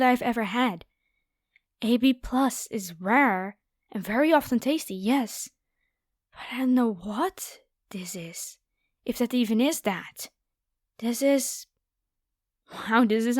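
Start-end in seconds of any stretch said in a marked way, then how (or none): none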